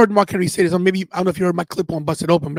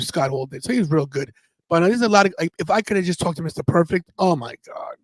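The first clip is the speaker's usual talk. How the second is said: usual speaking voice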